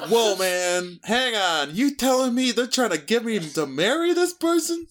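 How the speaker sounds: put-on high-pitched, incredulous New York accent. Balking at being married off, hammed-up New York accent. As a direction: protest in your best New York accent. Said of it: "That's my best New York accent".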